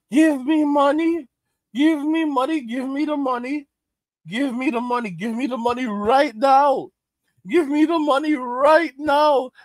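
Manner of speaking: mock a greedy kid demanding cash; demanding voice building to a shout. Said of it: "mocking Gen Z demanding".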